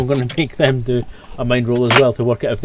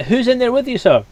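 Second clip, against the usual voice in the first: guard calling; 11 semitones higher, 3 dB louder than usual. Acted. Guard questioning through door.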